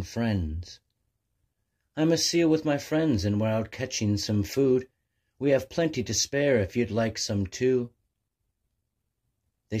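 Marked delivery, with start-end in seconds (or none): 2.0-7.9 much higher, louder and friendly at first; a bright, chirpy seal offering fish; cheery seal voice. Acted. Seal invites the hungry albatross to share, chirpy and high.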